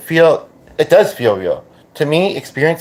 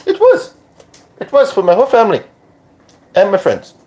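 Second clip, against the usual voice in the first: put-on much higher borrowed accent; pitched-up accent verging on British-Australian; 8 semitones higher than usual. He answers about his death, put-on accent. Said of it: "doing the accent".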